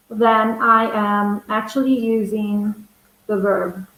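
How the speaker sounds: ordinary delivery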